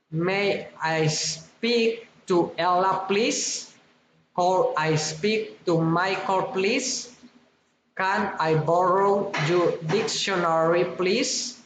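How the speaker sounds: "please" lifted at each end; rising requests. Shown as a demonstration. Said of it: "rising intonation".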